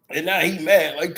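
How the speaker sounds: ordinary talk, nothing marked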